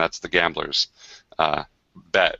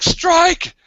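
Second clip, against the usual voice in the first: high, loud call to strike; battle cry; 6 dB louder than usual. Acted. Gambler starting the duel, high loud battle shout. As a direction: shout the attack. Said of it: "he's like".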